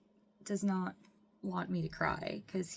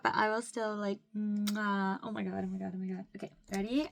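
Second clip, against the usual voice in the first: ordinary talk, nothing marked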